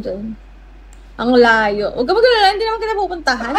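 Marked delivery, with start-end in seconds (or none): 1.9-3.5 mock reassurance. Real, not acted. Teasing reassurance to viewer.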